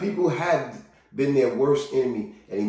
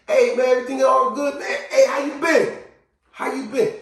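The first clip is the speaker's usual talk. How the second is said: high-pitched upbeat check-in; friendly hello, pitched well up; hearty greeting; 10 semitones higher than usual. Acted. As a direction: hail an acquaintance cheerily. Acting out greeting someone seen.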